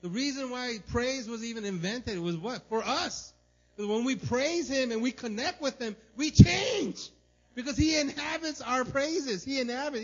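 high, loud, fervent preaching; preaching at full force. Acted. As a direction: drive the point home to the church. Preaching climax.

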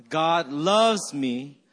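"loves" punched and drawn out, very slow; stress on LOVES. Shown as a demonstration. Pastor leading congregation slowly, stress on "loves". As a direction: hit the word "loves". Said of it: "Let's do this slowly".

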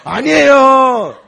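very high, loud mock-humble cry with a laugh; a church lady protesting from the floor; imitating a Korean ajuma.

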